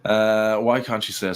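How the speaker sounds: usual speaking voice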